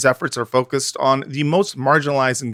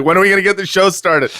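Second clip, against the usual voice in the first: mock impatient producer; 8 semitones higher, 5 dB louder, faster than usual. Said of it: "who's like".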